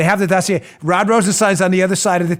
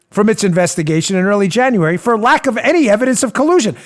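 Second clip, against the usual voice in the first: ordinary talk, nothing marked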